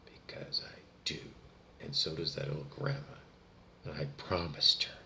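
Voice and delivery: real tearful near-whisper, low and soft; choked with emotion. Real, not acted. Insisting it is not their emotion, choked up. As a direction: barely get it out, hushed and overcome. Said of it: "making it seem like I'm getting emotional".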